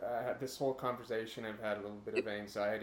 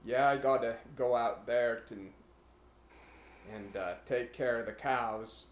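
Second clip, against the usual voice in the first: put-on rural Wyoming accent; 8 dB louder than usual. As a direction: play the rural Wyoming cattleman. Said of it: "impersonated them".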